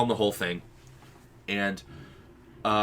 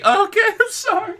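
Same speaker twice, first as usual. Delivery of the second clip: put-on very high squeaky frightened child; child apologizing to angry mom, very high; scared kid; 10 dB louder than usual. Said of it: "I'm gonna count to three".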